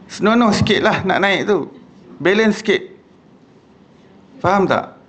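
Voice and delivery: man rebukes his clumsy companion angrily; hot-tempered scolding. Retold or imitated. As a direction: scold him like a short-tempered rider. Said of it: "Kalau orang yang panas baran".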